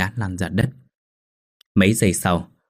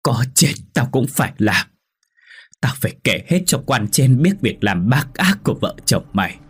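put-on old mother snapping with her last breath; defiant, pitch climbing high and loud by the end; angry snapping; 6 semitones higher, 3 dB louder than usual. A narrator's reading. Mother vowing to report her son's crimes, angry snapping. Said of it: "dùng chút hơi tàn mà gắt lên".

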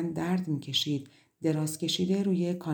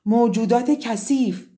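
high, loud, slow-drawn cry; desperate outburst at the top of the voice; desperate shout; 7 semitones higher, 11 dB louder, slower than usual. A narrator's reading. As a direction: shout it out helplessly, stretching the words. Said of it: "عاجزانه فریاد زد".

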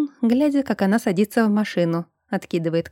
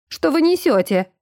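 mildly indignant, pitched up; 7 semitones higher than usual. A narrator's reading. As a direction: protest with a touch of outrage. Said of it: "возмутилась Айсидора".

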